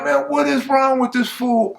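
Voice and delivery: high, incredulous aside; exasperated. Retold or imitated. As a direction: ask it in disbelief up high. Speaker's exasperated inner reaction.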